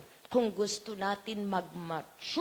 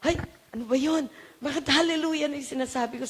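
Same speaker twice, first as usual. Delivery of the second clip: louder, fast puzzled outburst; mock shocked self-question; surprised exclaim; 5 semitones higher, 6 dB louder, faster than usual. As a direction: catch yourself in disbelief. Retold self-surprise at own words.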